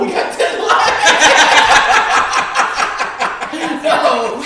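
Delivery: real delighted disbelief; cracking up at the like count; laughing outburst. Real, not acted. Reacting to reaching ten likes.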